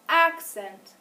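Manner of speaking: "Accent" starting high and loud, then falling; high first syllable. Shown as a demonstration. Teacher tests pupils on pitch, high stressed start to "Accent". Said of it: "if I say the first syllable higher or lower".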